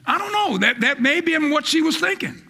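high, driving preacher's call; fired-up sermon delivery; full-force preaching. Acted. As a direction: preach it hard to the congregation.

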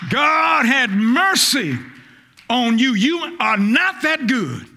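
God had mercy drawn out slow; deliberate preacher's emphasis; slow emphatic preaching. Acted. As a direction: lean on every word.

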